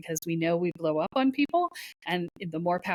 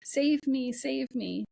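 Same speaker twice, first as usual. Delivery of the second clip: mock damsel in distress; 5 semitones higher, slower than usual. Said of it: "You're in damsel in distress".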